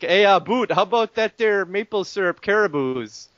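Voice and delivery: a hint of a hoser Canadian voice; mock Canadian accent the others say sounds the same. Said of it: "Canadian accent".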